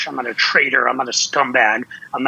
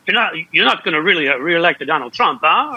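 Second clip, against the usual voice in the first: hammed-up Italian accent, higher rising "huh?"; 3 semitones higher than usual. People in Italy asking about Trump's reelection, hammed-up Italian accent. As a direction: do a playful imitation of an incredulous Italian. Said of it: "my accent".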